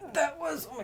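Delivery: high, laughing burst on that. Real, not acted. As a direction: laugh it out in disbelief. Laughs at how many books.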